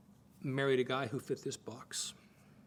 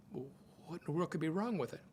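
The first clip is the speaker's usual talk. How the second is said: ordinary talk, nothing marked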